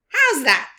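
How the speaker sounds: loud, high proud question; showing off his work; high, loud proud Baboon. A narrator's reading. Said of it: "he asked Rhino with pride".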